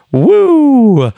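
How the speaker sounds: high, loud drawn-out woo; Ric Flair woo. Pretend. Imitating Ric Flair's trademark woo, loud and high. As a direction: belt out a signature wrestler's woo. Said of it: "comes back and gets in the ring and says".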